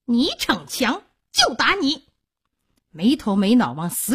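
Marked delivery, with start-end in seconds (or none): 0.1-2.1 high-pitched, snappish scolding; angry threat. A narrator's reading. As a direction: round on the child with a raised threat. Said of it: "萧娥掉头骂道". (凶狠地高声骂)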